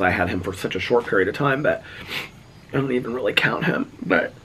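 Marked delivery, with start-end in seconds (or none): none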